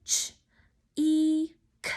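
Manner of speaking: "Ch, E, K" as separate sounds, ch, ee, k; sounded out. Shown as a demonstration. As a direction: say each sound of cheek alone with pauses. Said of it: "Break it with me".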